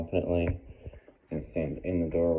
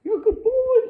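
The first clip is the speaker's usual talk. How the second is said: very high, loud squealed dog talk; excited praise; 10 dB louder than usual. Shown as a demonstration. Trainer proofing the dog's down, excited squeal.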